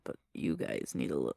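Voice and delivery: soft unvoiced aside; whispering. Real, not acted. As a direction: whisper this behind your hand.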